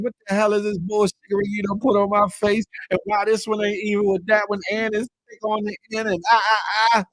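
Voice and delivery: high-pitched mock complaint; rattled, high retold outrage; imitated indignation. Acts out the client's outraged response.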